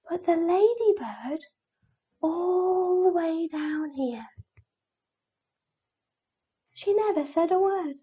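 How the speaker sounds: soft, high, tiny voice; hushed storytelling. A narrator's reading. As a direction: drop to a secretive hush for the little one. Tiny silent ladybird ends the story.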